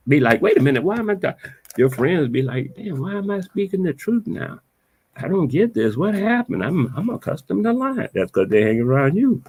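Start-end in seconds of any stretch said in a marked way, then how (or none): 0.4-1.3 high-pitched, puzzled friend; lightly acted, high-voiced confusion; mock bafflement. 2.8-8.1 high-pitched, baffled friend impression; puzzled protest in a raised pitch; mock bewilderment. Voicing friends confused by truth.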